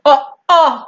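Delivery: normal talking voice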